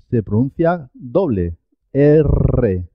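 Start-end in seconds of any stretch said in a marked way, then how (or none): 1.9-2.9 the R in erre rolled long; trilled R. Shown as a demonstration. Teacher showing learners how erre has to vibrate, rolled R. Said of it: "Tiene que vi-, vibrar".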